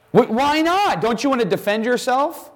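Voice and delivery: high, disbelieving questions; baffled Pilate. Pretend. Pilate pressing a silent Jesus, high and baffled. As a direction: stammer it out, amazed he won't speak.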